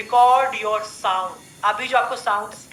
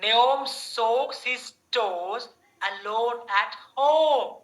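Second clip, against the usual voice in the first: slow repeat; much slower than usual. Shown as a demonstration. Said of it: "Repeat karo mere saath".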